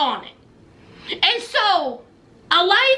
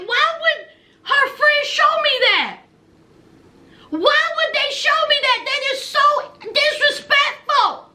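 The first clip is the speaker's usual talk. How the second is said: upset Gino voice; 7 semitones higher than usual. Retold or imitated. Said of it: "He's like".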